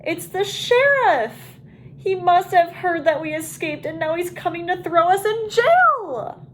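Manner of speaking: high, loud panic rising to a squeal on jail; a scared turkey blurting out the bad news; panicked shout. A narrator's reading. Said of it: "Wing shouted".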